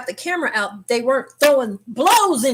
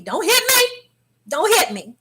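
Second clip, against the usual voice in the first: high-pitched pleading, easing into a laugh; mock panic; 9 semitones higher than usual. Passenger right beside the fight, high mock-frightened plea. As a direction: squeal it in playful alarm. Acting out fear of being hit.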